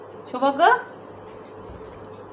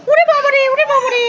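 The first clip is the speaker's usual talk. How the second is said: higher, hammed-up little yelp of fear; mock fright; 8 semitones higher than usual. Toy child yelping in mock fear at the mother's threat. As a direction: pretend to be scared of the scolding.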